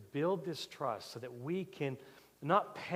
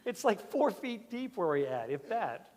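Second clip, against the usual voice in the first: laughing; 7 semitones higher, 5 dB louder than usual. Real, not acted. Laugh heard on line.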